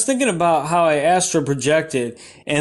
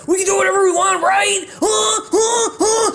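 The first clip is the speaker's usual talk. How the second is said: 1 manic goading; 12 semitones higher than usual. Acted. Defiant goading of audience.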